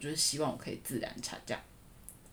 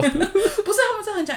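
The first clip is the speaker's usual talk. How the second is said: laughing; 12 dB louder than usual. Real, not acted. Laughing about coworkers' fuss.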